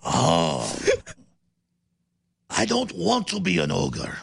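drawn-out mock accent, part Puss in Boots; badly faked cartoon accent, slow and hammy; Shrek. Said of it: "We're like Puss in Boots meets the".

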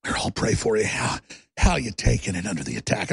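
low-pitched imitation of worried family; mock solemn concern; imitating concerned relatives. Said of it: "They're like".

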